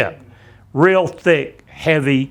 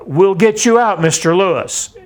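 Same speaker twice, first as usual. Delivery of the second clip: louder, higher call from the deck above; 6 semitones higher, 3 dB louder than usual. Retold or imitated. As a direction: reassure a blinded officer from a distance. Voice calling down from above.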